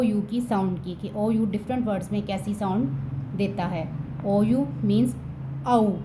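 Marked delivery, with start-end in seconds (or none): 5.7-5.9 "औ" said as a clear, drawn-out "au"; ou sound. Shown as a demonstration. Teacher demonstrates the ou sound for students. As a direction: give the pure "औ" vowel alone. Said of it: "ओ यू की sound आती है औ".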